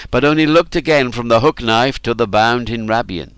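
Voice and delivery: usual speaking voice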